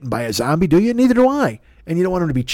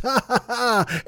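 higher, breathy villain laugh; evil laugh; 7 semitones higher, 4 dB quieter than usual. Acted. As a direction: gloat with a mad laugh. Villain laugh at roasting zombies.

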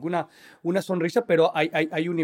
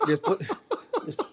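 laughing; 4 dB quieter than usual. Real, not acted. Laughs mid sentence.

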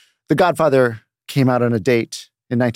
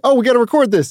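retold burst of excitement, much higher; excited realisation; 11 semitones higher, 3 dB louder, faster than usual. Telling Lucy they have to record their chat, excited. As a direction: pipe up suddenly, pitched high.

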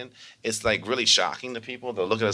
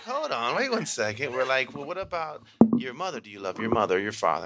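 playful teacher; 6 semitones higher than usual. Retold or imitated. Teachers voiced playfully to kids.

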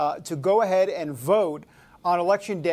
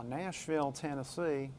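mock Southern accent; 5 semitones lower, 13 dB quieter than usual. Host jokingly signing off a Nashville guest in a faint Southern accent. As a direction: say "Nashville, Tennessee" in a weak put-on Southern accent, much softer. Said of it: "not really a great Southern accent".

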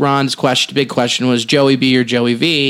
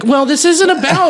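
high mock indignation; 11 semitones higher than usual. Retorting to a man's odd answer.

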